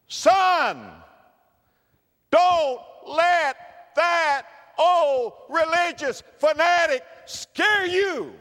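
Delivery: high, loud mocking old man; scoffing granddaddy. Granddaddy telling his son not to fear Noah, high and loud. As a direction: brush off the preacher with a loud laugh. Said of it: "When your granddaddy was a little boy".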